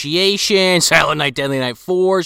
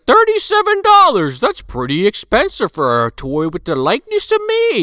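mock shocked Santa; 9 semitones higher than usual. Santa balking at his own toy, mock indignant. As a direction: play Santa aghast at the toy. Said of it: "Santa's like".

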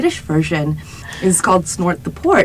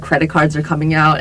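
usual speaking voice

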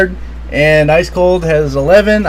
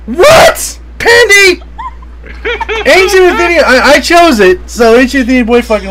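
very high, loud cries of disbelief; scandalised yelling, easing down at the end; incredulous outburst; 13 semitones higher, 6 dB louder than usual. Real, not acted. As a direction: shriek it, then protest fast and loud. Protesting at who wrote the card.

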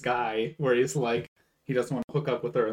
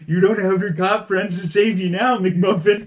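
raised, loud taunting character voice; taunting homeless guy; 7 semitones higher, 7 dB louder than usual. Retold or imitated. Homeless guy taunting a kid on the bus, mocking. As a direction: imitate a menacing heckler, pitched up. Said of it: "the homeless guy is there, and he's like".

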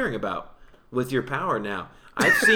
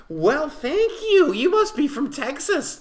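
high, slow put-on voice of a stranger; high voice imitating a stranger; 12 semitones higher, 6 dB louder, slower than usual. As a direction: play a gushing stranger, much higher and slowed. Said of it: "They go".

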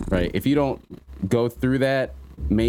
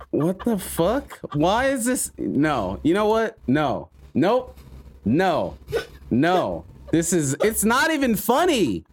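incredulous; 7 semitones higher than usual. Real, not acted. Reacting to a funny post.

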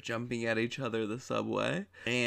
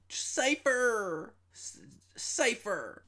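much higher, louder, slow whiny yelling of the name; annoying whiny yell; 12 semitones higher, 6 dB louder, much slower than usual. Retold or imitated. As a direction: wail the name like a bratty complaint. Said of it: "her voice just turned ... bitchy and annoying".

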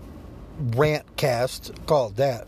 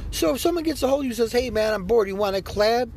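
fast recap; 8 semitones higher, faster than usual. Real, not acted. Retelling the collab ask, fast.

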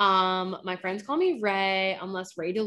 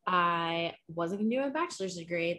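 ordinary delivery

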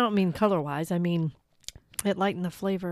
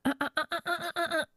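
scolding uh-uh; 10 semitones higher, faster than usual. Acted. Catching him with the bottle.

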